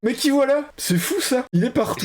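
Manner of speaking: voix montée, faussement étonnée; surprise amusée. Real, not acted. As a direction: feindre la surprise en riant. Acteur récurrent reconnu. (surprise amusée, voix plus aiguë)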